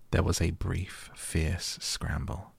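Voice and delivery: usual speaking voice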